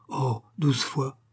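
slow, drawn-out disbelief; exclamation. A narrator's reading. Husband doubting wife's claim of twelve rings, exclaiming. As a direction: stretch it out sceptically. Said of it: "Lahuche poussa cette exclamation".

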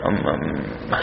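ordinary talk

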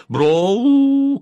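very high, loud, rising yell; displeased shout. A narrator's reading. Sleeper dislikes what he has understood, high loud yell. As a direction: holler it out in dreaming outrage. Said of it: "крикнул он".

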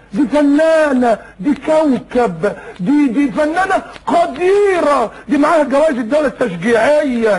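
high, very drawn-out mock praise; mock admiration. Voicing a fan defending an actress, gushing slowly and high. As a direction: stretch each title in exaggerated awe.

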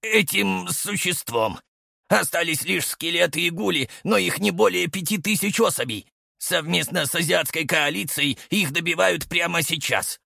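high, out of breath report; breathless. A narrator's reading. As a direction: gasp out the urgent report. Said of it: "задыхаясь, быстро заговорил".

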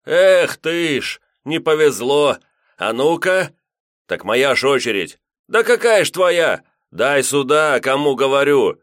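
loud, high, heated yelling; shouting quarrel. Acted. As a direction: bicker loudly over the dice. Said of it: "с громкими выкриками и руганью".

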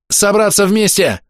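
high, drawn-out call; panicked cry. Acted. Биара orders the group together as monsters pour in, panicked shout. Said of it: "в панике закричала Биара".

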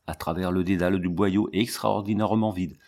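ordinary delivery

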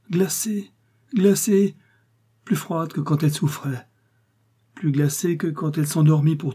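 high, shaken cry of horror; anguished cry, pitched high; horrified anguish. Acted. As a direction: recoil at the icy touch. Lover finds hands frozen.

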